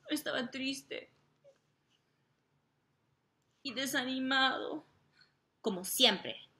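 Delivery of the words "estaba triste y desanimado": high, crying voice for sad Randy; a put-on teary voice for the sad hero; mock sad whimper. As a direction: whimper it like a sulking child.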